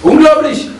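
exasperated cry of disbelief; indignant disbelief. Real, not acted. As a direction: blurt it out, scandalised. Outraged exclamation after story.

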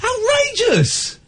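drawn-out high mock outrage. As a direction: stretch it out, hammed-up shock.

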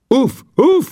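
crowd exclaiming. Acted. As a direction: gasp it out in amazement.

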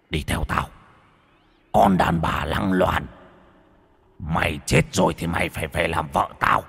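menacing ghost voice. A narrator's reading. Ghost drags wife away.

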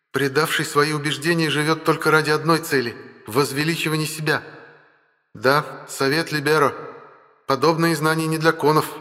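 high, imperious and mocking; lofty goddess talking down to a mortal. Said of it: "властный, чуть насмешливый голос богини".

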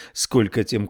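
plain reading voice

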